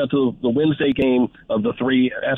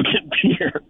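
normal talking voice

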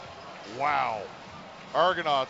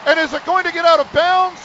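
soaring shouted live call, much higher and louder; excited play-by-play; 10 semitones higher, 13 dB louder than usual. Real, not acted. Radio announcer calling the last-second kick at full shout. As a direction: ride the final play at full cry like a live game call.

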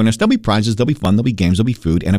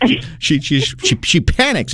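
ordinary talk

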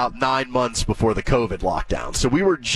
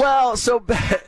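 laughing; 11 semitones higher, slower than usual. Real, not acted. Laughing start of answer.

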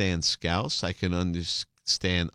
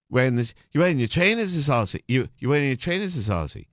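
higher-pitched imitation of a thick Scouse accent; Liverpool accent; 4 semitones higher, 3 dB louder than usual. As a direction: mimic a Scouse stage manager, words run together. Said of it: "the stage manager said".

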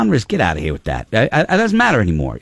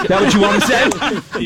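"you" leaned on, a laugh in it; stress on you; 8 semitones higher, faster than usual. Shown as a demonstration. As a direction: hit the word "you" and laugh it out. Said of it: "There's your demo".

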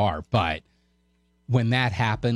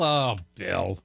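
sympathy running out; 3 semitones lower than usual. Retold or imitated. Co-host imitating everybody losing pity for the host, mock disappointment. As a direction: pitch dropping away into mock letdown. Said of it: "depletion of sympathy for you".

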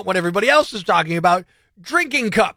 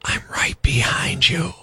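creepy whisper; 6 semitones lower, slower than usual. Shown as a demonstration. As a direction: lower slow whisper.

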